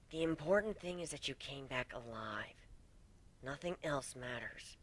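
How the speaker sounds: tender comfort. Acted. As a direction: hush him lovingly. Gilda hushing him tenderly.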